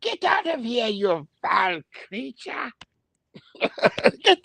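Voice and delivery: a high mock cry that slows down. Tribe member chasing off the woman, mock high cry. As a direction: shoo her off in a hammed-up elder voice. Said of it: "she probably was the one who said".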